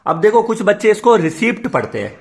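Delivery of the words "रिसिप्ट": रिसिप्ट with the silent P sounded; P pronounced. Shown as a demonstration. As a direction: say रिसिप्ट with an audible p before the t. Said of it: "पी silent होता है, रिसिप्ट नहीं पढ़ते".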